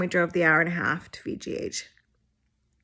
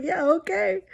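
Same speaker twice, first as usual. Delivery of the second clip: meek reply; 10 semitones higher than usual. Retold or imitated. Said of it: "I was like".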